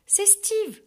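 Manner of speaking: high, bright exclamation of delight; exclaiming. A narrator's reading. Barbie spotting Steve in the park, exclaiming. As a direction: call out his name happily. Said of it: "s'exclame Barbie".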